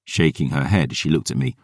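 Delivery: usual speaking voice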